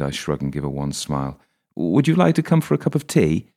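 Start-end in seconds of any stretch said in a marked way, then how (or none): 1.7-3.5 high, sing-song polite invitation; lightly voiced Julia, courteous; polite woman. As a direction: offer tea sweetly.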